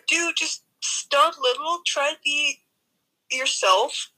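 ordinary talk, nothing marked

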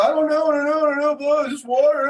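put-on a scared kid blurting denials; frantic denial. Son caught out, panicking and denying to his dad.

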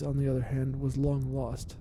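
usual speaking voice

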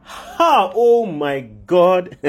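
high gasp, then slow drawn-out dismay; shocked God. Acted. God reacts to the fall of man, shocked exclamation. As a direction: stretch the words out in horror. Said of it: "That moment when God says, "Oh my God".

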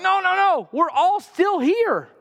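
urgent retold call, dragged out; crying out. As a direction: shout to reassure him from the dark. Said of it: "Paul cries out".

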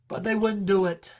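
usual speaking voice

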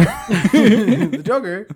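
very slow drawn-out laughing. Real, not acted. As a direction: stretch the name out laughing. Dragged-out laughing name.

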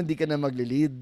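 ordinary talk

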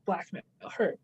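ordinary delivery

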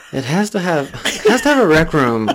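1 mock demanding buyer.

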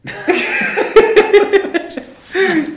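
loud, high burst of laughter; hearty laugh. Real, not acted. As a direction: crack up at the memory. Board hit by car.

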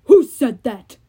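loud, slow giant voice; Cyclops voice. Acted. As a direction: boom it like a brutish giant.

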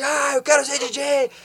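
slow, high, full shout across the valley. Retold or imitated. Son shouting his dream from the mountaintop, full shout. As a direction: yell it out from the mountaintop. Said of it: "Daí eu gritei assim".

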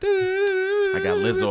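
very high, slow, crying-out hype line; hype squeal. Acted. Host hyping the crew in a very high slow squeal. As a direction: belt it out like a hype man. Hyped roll call.

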